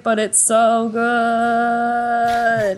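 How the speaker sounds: slow, almost sung moan of appreciation; agonized gushing. Real, not acted. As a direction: stretch it out in delighted torment. Host agonizing over a beloved chapter.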